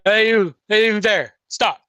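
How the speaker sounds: gruff shout of a city guard. Acted. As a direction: bark it like a gate guard. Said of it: "a gruff voice go".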